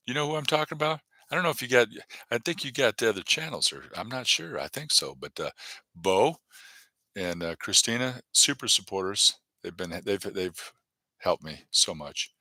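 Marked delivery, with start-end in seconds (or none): none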